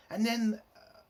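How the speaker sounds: ordinary talk, nothing marked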